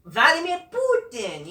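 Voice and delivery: high-pitched imitation Russian accent; mock Russian accent. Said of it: "She had a great Russian gimmick".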